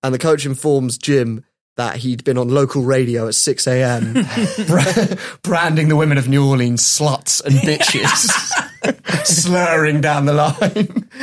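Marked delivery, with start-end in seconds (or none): 4.1-9.2 laughing through the words; trying to get it out while cracking up; amused, breaking into laughs; real laughter. Real, not acted. Cracking up at his own story. 9.2-11.1 drawn-out words through laughter; real helpless amusement; laughing. Real, not acted. Still cracking up at the story.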